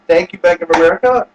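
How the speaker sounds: ordinary talk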